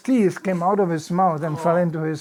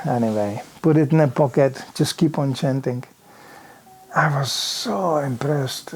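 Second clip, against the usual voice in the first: normal talking voice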